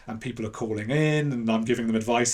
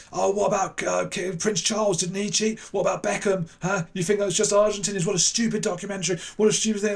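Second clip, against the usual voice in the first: mocking panellist; 9 semitones higher than usual. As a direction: jeer at the Englishman. TV panel attacking him.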